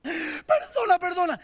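high, mock-tearful pleading; theatrical begging. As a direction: beg forgiveness with put-on crying. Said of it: "porque así son ellas". (súplica llorosa y teatral)

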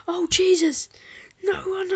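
high, loud shocked outburst; startled yell. Real, not acted. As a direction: jump out of your skin. Sudden shock in game.

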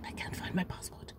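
a scared whisper so no one hears; whispered panic. Retold or imitated. Telling her sister the passport is gone in a whisper. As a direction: whisper it, barely a breath.

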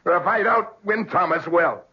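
high, strained threat, voice climbing; desperate threat. Acted. Beaten partner vowing to kill the tycoon, desperate and high.

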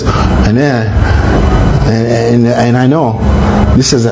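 imitating Munir. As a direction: stall like he's about to sneeze. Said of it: "he talks like this".

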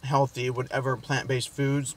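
talking while chewing; speaking between bites; mouth partly full. Real, not acted. Seen 1: Bites at -3 to -1 and +1.6; talks holding food, likely chewing.

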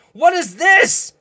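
real amazement at the card; a high, slow "what is this"; surprised. Real, not acted. Repeats the question in surprise.

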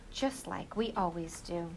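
ordinary delivery, nothing marked

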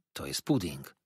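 low, subdued correction; quiet-mannered young guest; timid boy. A narrator's reading. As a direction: correct meekly, voice dropped. Boy meekly corrects the waiter.

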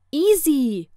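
loud, high call with a falling tune; calling after. A narrator's reading. Friend calls after her as she leaves, loudly. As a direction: call the name after someone leaving. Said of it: "rief Judy mir hinterher".

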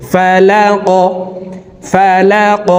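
ফালাক ending with a bouncing upward ক; qalqalah on qaf. Shown as a demonstration. Teacher demonstrates qalqalah ending for students. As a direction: push the final ক up with a jolt. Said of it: "ধাক্কা দিয়ে উচ্চারণ করব".

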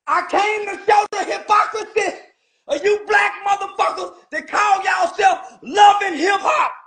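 real fury, shouting down the hypocrites; angry shouting rant. Real, not acted. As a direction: yell it at the whole industry. Angry rant at rap industry.

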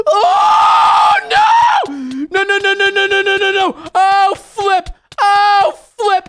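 very high, loud string of no; panicked screaming. Real, not acted. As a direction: scream in horror at the fall. Fails the jump and falls.

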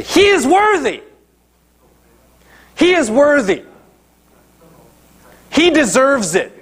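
the elders vouching for the centurion to Jesus, high and insistent. Acted. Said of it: "they point to him and they say".

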